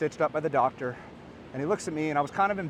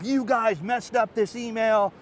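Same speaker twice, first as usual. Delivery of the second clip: higher, slow put-on voice of an annoyed customer; mock customer complaint; 7 semitones higher, 4 dB louder, slower than usual. Customers phoning the company about the broken email, mock complaint. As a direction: play the irritated reader, drawn out.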